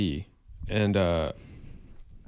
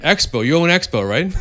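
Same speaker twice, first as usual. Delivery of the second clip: louder, higher casual check; imitating Momoa; 7 semitones higher, 10 dB louder than usual. Jason Momoa on the phone to the owner, loud breezy mock voice.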